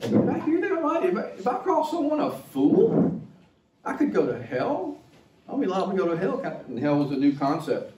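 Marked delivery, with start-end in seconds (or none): 0.0-1.0 much higher, incredulous squeak; shocked disbelief. Retold or imitated. Disciple repeating Jesus's words, squeaking with shock. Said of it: "the people probably thought". 1.0-6.4 high, incredulous pleading questions; alarmed disbelief. Retold or imitated. Disciple fearing hell for calling someone fool, alarmed. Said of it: "the people probably thought".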